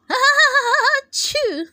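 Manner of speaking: high, loud comic sneeze. A narrator's reading. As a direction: sneeze it out big. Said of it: "repeated the fans".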